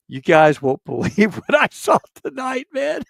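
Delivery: high, loud gleeful needling; taunting buddy; mock teasing. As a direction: rub it in with a grin. Teasing the major at cards.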